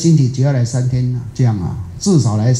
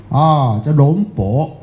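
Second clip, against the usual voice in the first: ordinary talk, nothing marked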